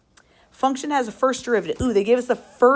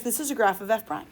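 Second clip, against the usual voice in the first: usual speaking voice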